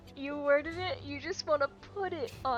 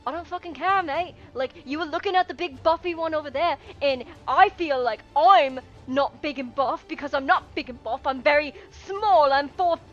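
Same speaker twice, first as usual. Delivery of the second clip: jealous gnome ranting; 2 semitones higher, 8 dB louder than usual. Acted. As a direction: rant at your partner like a wounded little gnome. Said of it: "the other one's like".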